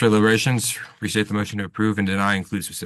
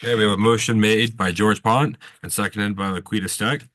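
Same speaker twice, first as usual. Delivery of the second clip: usual speaking voice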